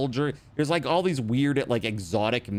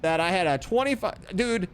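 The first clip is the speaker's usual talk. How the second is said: high, alarmed disbelief; shocked; 10 semitones higher than usual. Real, not acted. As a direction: react with sudden alarm. Shocked at game infection.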